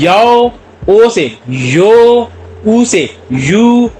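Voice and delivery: slow drawn-out यो and यू; vowel glide demo. Shown as a demonstration. Teacher showing how vowels combine, very slowly. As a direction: stretch each glide out for learners. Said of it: "आओ से निकला है".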